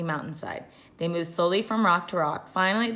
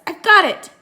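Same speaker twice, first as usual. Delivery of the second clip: higher and louder, a sudden eureka; excited exclamation; 11 semitones higher, 11 dB louder than usual. A narrator's reading. Jack remembering the shadow-stick trick, excited. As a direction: burst out with the discovery.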